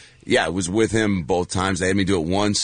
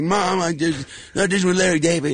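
higher-pitched, giddy call to mom; excited retold news; 10 semitones higher than usual. Actor retells phoning mom after the audition, excited. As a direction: breathlessly share big news, imitated.

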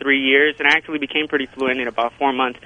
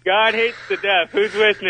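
mild mock yell, barely raised; half-hearted yell; 5 semitones higher than usual. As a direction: meant to roar, comes out timid. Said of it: "You're not yelling".

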